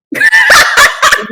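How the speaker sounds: high shrieking laugh; cackling at her own joke; loud burst of laughter. Real, not acted. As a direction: break into a big real laugh.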